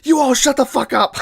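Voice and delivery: the friend who set this up screams at the mocking group; furious yell. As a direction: lose it and scream at them all. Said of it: "Tony flips out".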